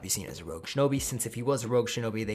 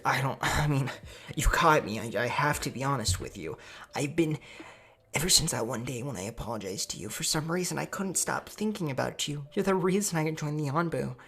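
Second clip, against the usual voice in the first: higher, put-on smitten girl voice; girlish love confession; 5 semitones higher than usual. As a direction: confess haltingly to your crush.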